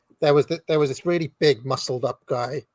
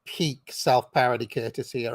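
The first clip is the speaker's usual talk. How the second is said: usual speaking voice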